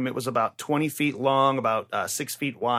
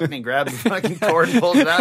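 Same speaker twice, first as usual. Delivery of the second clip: ordinary talk, nothing marked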